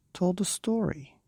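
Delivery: sing-song lift. A narrator's reading. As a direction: lift it up like a tale. Storyteller's lilt.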